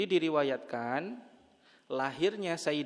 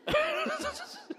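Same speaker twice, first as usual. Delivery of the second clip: a high burst of laughter. Real, not acted. Preacher laughing as she imagines the women hearing such news. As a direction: laugh at the thought of it. Imagining being told of death.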